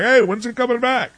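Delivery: high-pitched mock fan question; imitating eager fans. Sports commentator mimicking fans who shrug off scandal, high mock-eager voice. Mocking fans' eager indifference.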